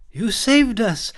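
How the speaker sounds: high, loud and slow, a bright cry of relief; fairy gushing with relief, high and loud; musical exclamation. A narrator's reading. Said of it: "Anastasia exclaimed in a musical voice".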